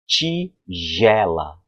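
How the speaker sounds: "Tigela" drawn out as "tchiii-jela"; slow "tch" pronunciation. Shown as a demonstration. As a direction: stretch "Tigela" slowly, opening on a ch sound. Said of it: "normalmente eu falo".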